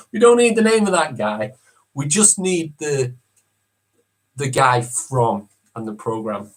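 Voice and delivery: ordinary talk, nothing marked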